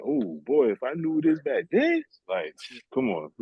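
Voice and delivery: high-pitched exclamation at hindsight; mock exclaiming. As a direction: pitch climbs high on a playful what-if. Acts out present reaction to hindsight.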